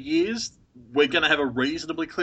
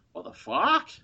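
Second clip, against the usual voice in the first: slow, drawn-out Australian "what the fuck"; broad Australian, slow and exasperated; Australian exasperation; 12 semitones higher, 3 dB louder, slower than usual. Shown as a demonstration. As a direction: demo the Aussie swear in context, exasperated. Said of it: "it can be used in a lot of contexts".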